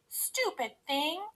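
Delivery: high, slow sulky complaint; cross. A narrator's reading. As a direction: sulk slowly at the thing that broke. Cross at broken chair.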